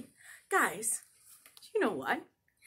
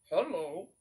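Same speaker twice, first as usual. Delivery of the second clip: frogs greeting; 9 semitones higher, slower than usual. Acted. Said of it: "And they said".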